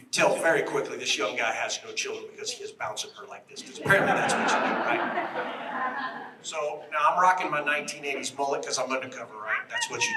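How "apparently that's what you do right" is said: amused laugh. Real, not acted. Laughing at the young agent.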